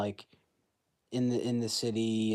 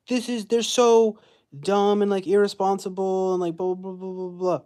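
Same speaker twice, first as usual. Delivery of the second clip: high, loud, sneering parody of critics; mocking whine; 8 semitones higher, 6 dB louder than usual. Vlogger mocking online critics who call the heroine dumb, high sneering whine. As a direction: whine it like a prissy know-it-all.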